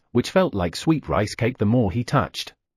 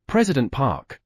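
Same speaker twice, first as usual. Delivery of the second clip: usual speaking voice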